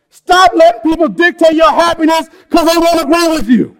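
preaching at full force. Acted. Pastor urging the angry congregation to hunger after Jesus, fervent preaching. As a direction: proclaim it to the whole room like a revival preacher.

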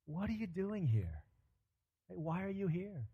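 soft, searching appeal; hushed question that invites an honest answer; gentle probing question. Acted. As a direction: ask it quietly, with care. God's searching question to Elijah.

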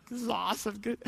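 hushed aside, as if in on the secret; imagined quiet gloating; secret glee. Pretend. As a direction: say it under the breath, privately pleased. Preacher voices Jesus's secret glee.

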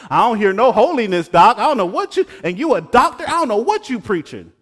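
mock challenge. Younger self heckling his seminary teacher, brash mock. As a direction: needle the doctor like a know-it-all. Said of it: "I'm like".

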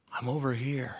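very soft, low calling. Acted. God softly calling someone who isn't listening. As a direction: murmur it gently from close by.

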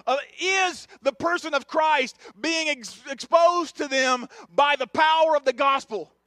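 loud, driving pulpit delivery; impassioned sermon, building by repetition; full-force preaching. Real, not acted. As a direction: hammer the point home to the congregation.

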